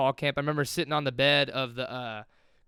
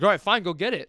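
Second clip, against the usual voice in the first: a louder, higher mock of an irritated coach giving in; retold annoyed coach; 5 semitones higher, 7 dB louder than usual. Coach letting the freshman return the kick, mock irritated. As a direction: grudgingly wave him onto the field. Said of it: "Like, kinda pissed".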